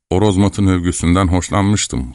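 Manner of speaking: ordinary delivery, nothing marked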